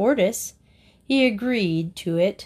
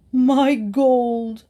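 louder, higher, wailing miser voice; wild cry; 4 semitones higher, 4 dB louder than usual. A narrator's reading. Miser crying out over his stolen gold, wild wail. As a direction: wail it out like he's been robbed. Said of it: "cried the miser wildly".

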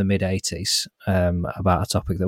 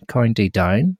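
Northern Irish accent; 5 dB louder, slower than usual. Shown as a demonstration. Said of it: "That's very, not bad".

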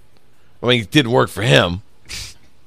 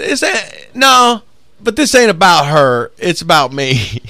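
pitched-up, slowed mock of a selfish guy; mimicking the guy; 8 semitones higher, 6 dB louder, slower than usual. Retelling the date's guy insisting it's about him, mock voice. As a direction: ham up his self-centred answer, slowly. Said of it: "He said".

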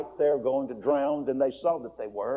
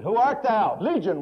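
wild reply; 7 semitones higher, 4 dB louder than usual. Acted. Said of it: "was the quick, wild tone reply".

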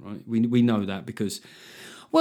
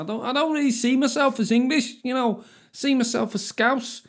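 high mocking voice of a wannabe Scouser; mocking bloke; 12 semitones higher than usual. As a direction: pitch it up to mock the fake Scouser. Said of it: "says a bloke mate of yours".

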